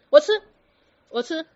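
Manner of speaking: sudden high loud yelp of giving in; frightened blurt. Retold or imitated. Scolded patient blurting to his teacher, loud yelp. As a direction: blurt it out fast like a cowed patient.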